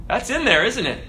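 ordinary talk

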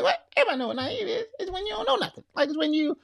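high squeaky disbelief; incredulous dad. Retold or imitated. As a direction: act baffled it's so obvious. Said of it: "I was like".

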